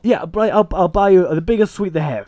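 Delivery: high, eager mock pleading; hammed-up desperate promises; begging. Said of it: "starts begging him".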